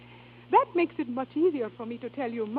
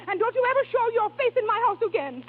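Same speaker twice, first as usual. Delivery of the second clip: furious; 8 semitones higher, 4 dB louder than usual. Acted. Diva throwing husband out.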